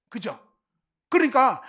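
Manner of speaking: usual speaking voice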